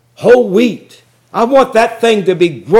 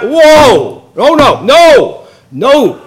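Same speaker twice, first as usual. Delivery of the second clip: loud, higher, drawn-out no, no, no; shocked outcry; 5 semitones higher, 5 dB louder, much slower than usual. Real, not acted. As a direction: recoil at what you just read. Shocked by the verse.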